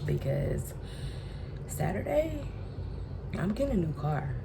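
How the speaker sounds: ordinary talk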